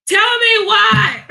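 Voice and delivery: slow, loud, high outburst; drawn-out shouted indignation; outraged shout. Real, not acted. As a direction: belt it out in disbelief. Outraged at 4 am calls.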